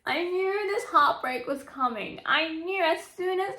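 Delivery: high, slow anguished cry; heartbroken wail. Real, not acted. Reader wailing to viewers about the heartbreak, high and slow. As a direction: wail over the breakup you saw coming.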